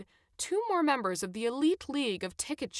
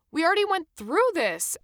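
raised, high-pitched angry protest; 10 semitones higher, 9 dB louder than usual. A narrator's reading. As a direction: object loudly and angrily to being stopped. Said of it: "Adam said angrily".

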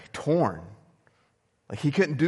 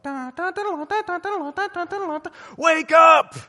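high monotone electronic jingle, then shouted wake-up; steady high beeping voice bursting into a shout; imitating annoying alarm; 7 dB louder than usual. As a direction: rattle off a piercing alarm tune, then yell. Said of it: "an alarm system that would go off".

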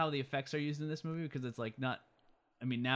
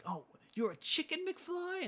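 much higher jeering taunt; mock taunt; slower than usual. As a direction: sneer it like a bully. Griff taunting Marty.

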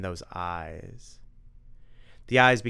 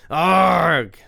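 much higher, loud howl; anguished vampire howl; 8 semitones higher, 14 dB louder than usual. Acted. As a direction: howl as the joke lands. Vampire hit by pun.

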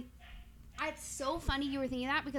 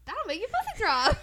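excited quip; 7 semitones higher, 8 dB louder than usual. Acted. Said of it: "I was literally just gonna say".

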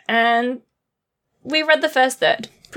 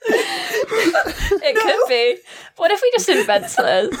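laughing; 8 semitones higher, slower than usual. Real, not acted. Joking about invented slurs.

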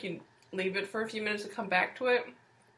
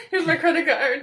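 laughing mock reply; 8 semitones higher, 9 dB louder than usual. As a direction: giggle through the line. Mock quote while laughing.

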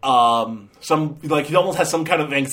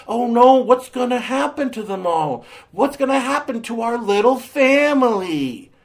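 high-pitched, hammed-up dismay; mock melodrama; 5 semitones higher than usual. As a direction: fret like an overwrought soap narrator. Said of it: "all sad and depressing".

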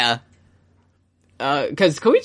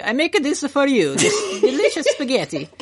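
high-pitched chef voice offering a dish, much higher; Tonio Trendy impression; 11 semitones higher than usual. Podcast host voicing Tonio serving Jotaro, high eager chef voice. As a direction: pitch it way up, the eager cook presenting his spaghetti. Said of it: "no, you nailed it".

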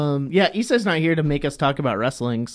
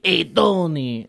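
slow, higher mock-gruff call; gruff New York attempt; 4 semitones higher, much slower than usual. Shown as a demonstration. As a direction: draw it out, trying to be all gruff. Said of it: "trying to be all gruff".

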